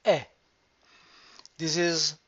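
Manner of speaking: ordinary talk, nothing marked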